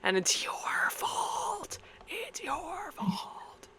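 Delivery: high breathy ghostly whisper, slowing; eerie whisper. Pretend. Mock suicide note blame.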